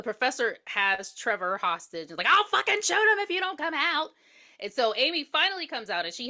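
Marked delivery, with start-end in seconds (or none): 2.3-4.1 high mock threatening yell; retold threat. Retelling the professor threatening Amy, mock yell. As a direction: play the hostage-taker barking a threat.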